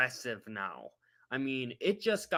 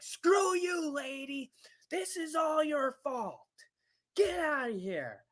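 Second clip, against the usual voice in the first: snapping in anger; 12 semitones higher than usual. Retold or imitated. Telling off the filming Karen, furious shout. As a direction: scream it at her, fed up and furious. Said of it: "I just frickin' snapped".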